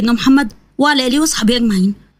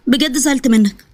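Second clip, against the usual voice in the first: usual speaking voice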